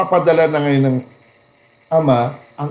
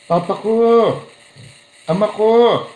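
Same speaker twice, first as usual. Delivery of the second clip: fond, raised "Papa ko! Ama ko!"; claiming call; 7 semitones higher than usual. Shown as a demonstration. Preacher showing a child claiming the father, fondly. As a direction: call out claiming him as yours. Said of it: "Inaangkin niya talaga siya".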